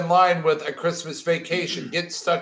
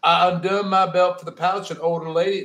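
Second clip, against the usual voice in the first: ordinary talk, nothing marked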